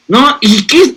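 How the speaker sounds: ordinary delivery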